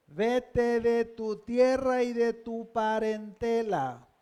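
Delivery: lectura solemne y lenta de un mandato; orden divina leída. Retold or imitated. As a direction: lee la orden marcando despacio cada palabra. Lee en voz alta la orden divina. (mandato bíblico, pausado y sostenido)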